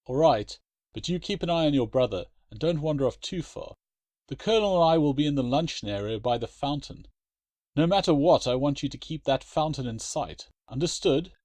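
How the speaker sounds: father warning his daughter before she goes; stern father. Acted. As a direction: lay down the rules.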